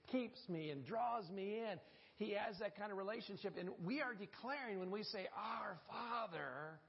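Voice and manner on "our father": slow reverent prayer. Acted.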